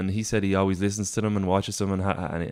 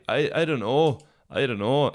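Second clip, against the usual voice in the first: ordinary talk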